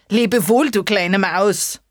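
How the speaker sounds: loud, hasty goodbye; fox farewell. Acted. Fox calling goodbye as he leaps away. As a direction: call it over the shoulder. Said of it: "rief er".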